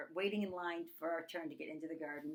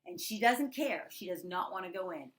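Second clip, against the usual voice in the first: ordinary talk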